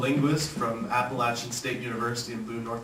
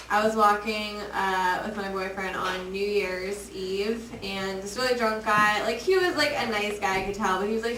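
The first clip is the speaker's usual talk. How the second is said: ordinary talk, nothing marked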